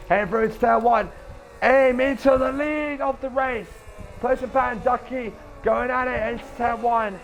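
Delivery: fast, high live race call; race caller. Real, not acted. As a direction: call the restart like a commentator.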